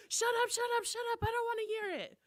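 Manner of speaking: high, loud, rapid-repeated refusal; mock angry outburst. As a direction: imitate someone yelling to block out the words. Acts out friend refusing to listen.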